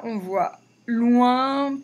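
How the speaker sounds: stretched out, word by word; slow model reading. Shown as a demonstration. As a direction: read it slowly for the class. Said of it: "donc là".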